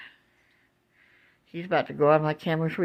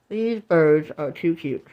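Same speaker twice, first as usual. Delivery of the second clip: ordinary talk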